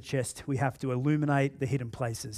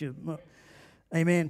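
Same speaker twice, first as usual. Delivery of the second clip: ordinary delivery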